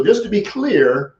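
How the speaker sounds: slow "just to be clear", lifted high; slow, deliberate. Shown as a demonstration. Coach repeating the reply, slow and pointed. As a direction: stretch the opener with calm control. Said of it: "You see that?".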